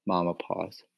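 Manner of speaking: usual speaking voice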